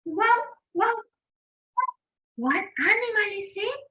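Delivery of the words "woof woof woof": high-pitched imitated dog woofs; dog bark imitation. Teacher asking children to guess the animal, imitated dog bark. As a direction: woof like a dog, pitched well up. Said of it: "The second animal says".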